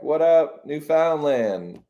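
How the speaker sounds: imitating a hyped greeting from the stage; crowd greeting. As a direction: hail the concert crowd. Retold greeting to show crowd.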